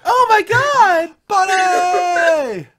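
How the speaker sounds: squealing high-pitched yell of joy; excited shout. Real, not acted. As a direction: scream the greeting with delight. Excited greeting of returning guest.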